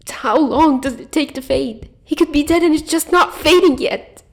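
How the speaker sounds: high, raw and desperate, building; tearful protest. Acted. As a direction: push back through tears. Julia countering in grief.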